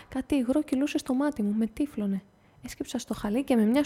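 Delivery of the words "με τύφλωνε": usual speaking voice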